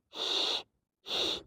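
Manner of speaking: SH as a voiceless hush, no vowel; isolated sh sound. Shown as a demonstration. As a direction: blow a soft, sustained SH. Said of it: "Jadi dibacanya".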